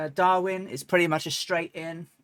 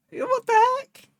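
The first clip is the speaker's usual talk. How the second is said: a much higher, louder what the heck; baffled exclamation; 6 dB louder, slower than usual. Real, not acted. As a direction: yelp in disbelief at the broken propeller. Propeller won't spin, baffled.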